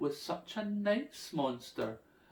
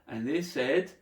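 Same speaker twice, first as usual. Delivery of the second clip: ordinary delivery, nothing marked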